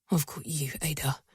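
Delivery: deep, soft male murmur; low tender murmur. A narrator's reading. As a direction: hold her close and murmur. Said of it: "I hear him murmur".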